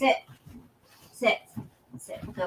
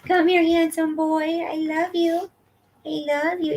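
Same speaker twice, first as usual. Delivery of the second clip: pet talk; 6 semitones higher than usual. Real, not acted. Owner cooing love to her handsome boy, high baby talk. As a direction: coo it up high to the dog.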